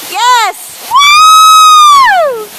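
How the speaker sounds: full-voice whoop, very high and loud; cheering shout. Real, not acted. Vlogger whoops as Ricky jumps, real excited shout. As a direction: let out a huge celebratory woo.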